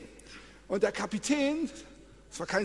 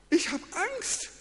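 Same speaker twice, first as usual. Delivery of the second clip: high, slow whimpered fear; mock fear; 12 semitones higher than usual. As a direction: sound small and scared. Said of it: "ich hab Angst bekommen".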